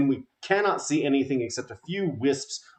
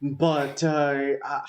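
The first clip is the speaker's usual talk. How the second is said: slightly raised, flustered cook at a loss; a touch of panic, lightly voiced; overwhelmed Abigail. Baker buried in chicken skewers, mildly overwhelmed. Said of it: "she's just overwhelmed".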